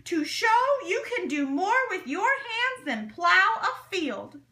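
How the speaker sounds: high, loud and rousing. A narrator's reading. Speaker daring the men to prove themselves, loud and rousing. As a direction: push the challenge to the crowd. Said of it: "His voice became louder".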